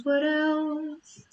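high, playful sing-song musing. Acted. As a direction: lilt it up playfully. Musing aloud, sing-song repeat.

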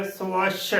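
"ਸਵੱਛ" said so-WASH, rounded o first; correct pronunciation. Shown as a demonstration. Teacher giving learners the correct word, careful pronunciation. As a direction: say "ਸਵੱਛ" with so before a full wa-sh. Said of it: "ਇਸ ਦਾ ਸ਼ੁੱਧ ਉਚਾਰਨ ਹੈ".

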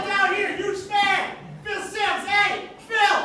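high, loud, desperate calls of Phil; shouting up at the booth. Retold or imitated. Fan in the aisle calling to Phil Simms, loud hopeful shouting. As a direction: yell up to a booth, begging to be noticed. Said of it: "he starts shouting at the press booth".

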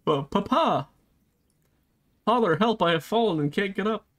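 a hint of a pleading kid; lightly hammed-up cry for help; kid calling for help. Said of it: "She's like".

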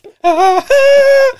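very high, loud laugh; shrieking laugh. Real, not acted. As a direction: let out a shrill cackle.

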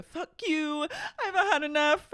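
high hammed-up outburst; mock freak out. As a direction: fake a public meltdown. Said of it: "he needs to do, like, a".

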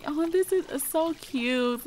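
drawn-out high-pitched delight; cooing admiration. Acted. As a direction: gush gently over something cute. Voices both Sims admiring the scenery.

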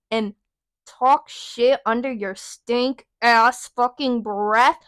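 ordinary delivery

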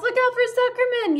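very high warning cry; gander yelling. A narrator's reading. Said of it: "yelled the gander".

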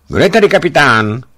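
high, loud feverish woman's call; a sick woman's sudden loud cry; woman calling from the car. A narrator's reading. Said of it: "ozval se z vozu ženský hlas".

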